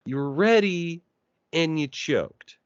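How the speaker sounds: mock taunt. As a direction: scold them like a coach. Mocking the choking team.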